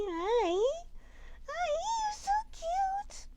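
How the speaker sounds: very high squeaky cooing. Real, not acted. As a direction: gush over a cute puppy. Cooing at the puppy.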